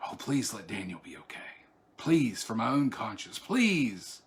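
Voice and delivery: soft, low desperate plea; quiet pleading prayer. Pretend. King begging his god for Daniel, soft pleading prayer. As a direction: whisper a prayer under your breath. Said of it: "in that lamenting voice".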